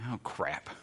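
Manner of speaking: a quiet caught-out groan; mock dismay. Preacher voicing the listener realizing their fault, soft mock dismay. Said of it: "just saying those things has already made you think".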